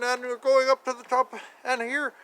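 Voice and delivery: ordinary delivery, nothing marked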